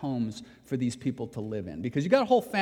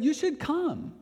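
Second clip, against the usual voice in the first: higher coaxing insistence; pastor insisting; 13 semitones higher, slower than usual. Retold or imitated. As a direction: coax him, pitched up. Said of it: "He said".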